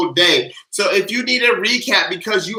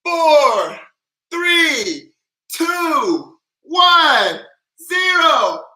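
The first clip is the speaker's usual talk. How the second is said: rising higher and louder count; hyped countdown; 7 semitones higher, 4 dB louder, much slower than usual. Shown as a demonstration. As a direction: build the countdown to the big moment. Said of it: "I'm gonna count down from five".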